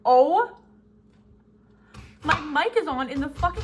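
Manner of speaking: loud, high startled "Oh?". Retold or imitated. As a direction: caught off guard, blurt it out loud. Realizing the mic is on.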